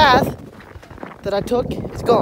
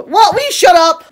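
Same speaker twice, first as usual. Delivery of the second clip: sharp loud yell, pitched up; shouting at the dog; 10 semitones higher, 8 dB louder than usual. Real, not acted. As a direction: snap loudly at the noisy dog. Yelling at the barking dog.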